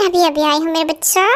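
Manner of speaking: ordinary delivery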